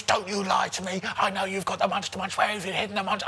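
high-pitched mock tirade; semi-yelled, high accusing rant; imitated screaming accusation. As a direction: rant like a drunk, outraged customer. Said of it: "she's screaming, she's shouting like nobody's business".

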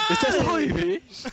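high, slow question breaking into laughter; laughing incredulously. Real, not acted. Doubting a mate's Irish accent, real helpless laughter. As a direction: crack up while asking it.

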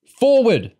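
high, loud command; ordering. A narrator's reading. Girl ordering stretcher bearers on, commanding. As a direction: shout a marching order. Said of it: "Lauren ordered".

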